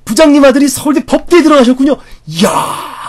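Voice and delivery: very high and loud, gushing amazement; loud, exaggerated awe at the good news; over-the-top admiration. Shown as a demonstration. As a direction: flatter the boss with huge delight. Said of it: "이렇게 오버해줘야 되는 거예요".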